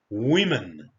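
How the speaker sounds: WOMEN firmly as WIM-in, falling at the end; required plural pronunciation. Shown as a demonstration. Teacher tells learners the required sound, WOMEN as WIM-in. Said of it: "you must pronounce it as".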